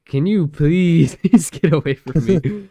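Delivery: loud, high, drawn-out begging with a laugh; exasperated plea. Acted. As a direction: beg them to leave, slow and hammed-up. Acts out reaction to kissing couples.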